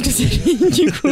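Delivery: giggling while saying it, pitch up; a shy laugh breaking in. Real, not acted. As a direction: laugh through the admission. Naming the odd-looking actor.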